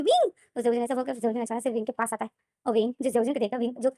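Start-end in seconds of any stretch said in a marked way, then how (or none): 0.0-0.3 shout. Acted. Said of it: "जोर से चीख मारता है".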